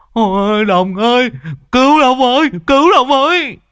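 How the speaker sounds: loud, very high howl; screaming for help. Acted. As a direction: howl desperately for someone to save you. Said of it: "mồm nó rú lên".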